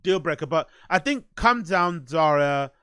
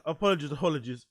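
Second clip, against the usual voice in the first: ordinary talk, nothing marked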